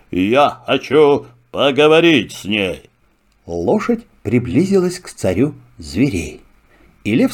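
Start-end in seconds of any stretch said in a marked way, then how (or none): 0.1-2.9 lion voice. Acted. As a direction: speak as a calm king of beasts. Lion speaks. (голос льва)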